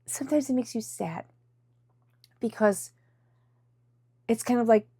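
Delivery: fighting back real tears; quietly choked up; about to cry. Real, not acted. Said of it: "I'm gonna cry".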